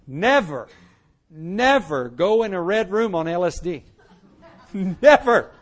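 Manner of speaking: warning laced with real laughter; laughing never. Real, not acted. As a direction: laugh through the advice.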